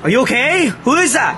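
loud shouted question into the dark; yelling toward the unseen voice; calling out loud. Acted. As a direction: call out to whoever screamed. Calling out to a screamer.

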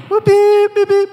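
high, loud electronic beeping noise; imitated doorbell beeps. Storyteller mimicking his doorbell ringing, high loud beeps. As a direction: beep like a door camera chime.

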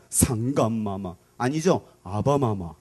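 low, slow courtly address; slow down, drop low, call reverently to royalty; prince addressing the king. Acted. Prince greeting the king each morning, low courtly voice.

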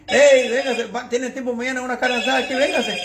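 high, loud friendly call to come over; cheerful shouted invite; excited invitation. Retold or imitated. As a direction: call out to a buddy to come eat. Friends inviting to carne asada.

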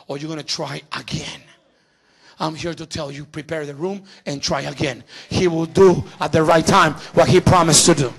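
usual speaking voice

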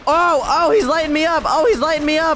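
high, loud alarm under fire; panicked yelling. Real, not acted. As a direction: yelp as the hits land.

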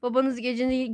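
usual speaking voice

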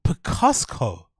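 slow mocking "pi-KOS-ko" for Picasso; mispronounced Picasso. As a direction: draw out Picasso as "pi-KAHS-ko". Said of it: "Have you ever heard anybody pronounce".

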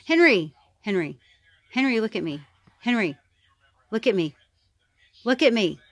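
loud coaxing call for Henry; sing-song calling to Henry. Real, not acted. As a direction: call the pet to look at the camera. Calling the pet for attention.

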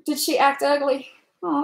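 ordinary talk, nothing marked

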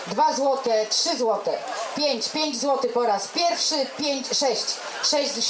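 loud auctioneer calling bids. Real, not acted. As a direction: rattle off the bids loudly like an auctioneer.

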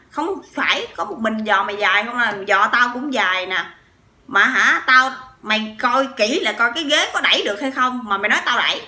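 mock thug anger. Husband snapping back at the man in front, loud retold anger. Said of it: "nổi máu côn đồ lên".

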